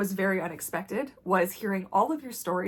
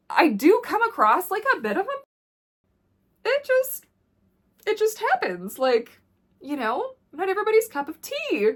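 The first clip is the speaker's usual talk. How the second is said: mock sweetness; 11 semitones higher, 3 dB louder than usual. Describing how she comes across.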